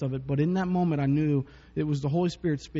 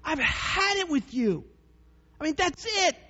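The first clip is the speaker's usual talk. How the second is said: high, slow mock-exasperated parent; hammed-up parent at the end of his rope; exasperated parent rant; 12 semitones higher, slower than usual. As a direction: play the fed-up parent, pitched way up. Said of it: "I'll be like".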